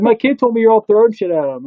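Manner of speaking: a hammed-up mom standing up for her kid; angry mom. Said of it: "She's like".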